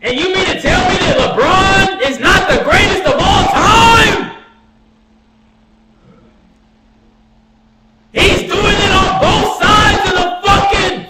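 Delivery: high-pitched, excited hollering; incredulous rant. Real, not acted. Fan arguing LeBron is the greatest, incredulous yell. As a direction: argue at the top of your range, astonished. Heated fan reaction to highlights.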